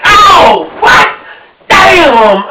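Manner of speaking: high startled yelp; hammed-up shocked outburst; mock shock. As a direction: act caught out by the question. Guy shocked by car seats.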